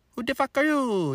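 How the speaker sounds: high, hammed-up Italian accent, swooping down at the end; Mario-style Italian accent. Startled Italian man to a stranger speaking Italian, mock Italian accent. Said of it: "that's my Mario accent".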